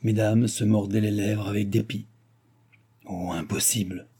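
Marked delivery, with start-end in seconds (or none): 3.1-4.1 soft, subdued murmur; muffled reply. A narrator's reading. Wounded duchess answering the marshal, in a muffled voice. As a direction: drop the voice, dully. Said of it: "répondait sourdement madame de Gramont".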